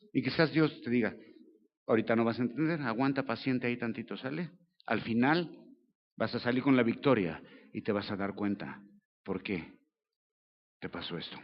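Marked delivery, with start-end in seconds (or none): 1.9-11.4 gentle reply. Retold or imitated. God answers gently.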